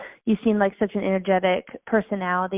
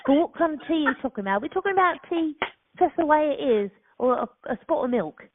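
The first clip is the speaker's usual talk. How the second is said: put-on playful, higher-pitched London accent; best English accent; 4 semitones higher than usual. Friend trying out an English accent on request, London accent. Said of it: "I want your best English accent".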